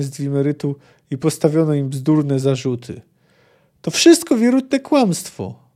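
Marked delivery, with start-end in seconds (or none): 3.8-5.7 a sorceress yelling at the council; sudden yell. Acted. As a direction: scream it out at the whole council. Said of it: "wrzasnęła nagle Sabrina Glewisik".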